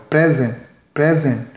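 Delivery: Present as PREZ-ent, weight up front; present with a full first vowel and light ending; stress on first syllable. Shown as a demonstration. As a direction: lean on PRE in present, let the end fall. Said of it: "पहले syllable पर stress है".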